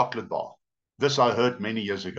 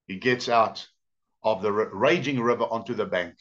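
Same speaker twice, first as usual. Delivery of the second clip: usual speaking voice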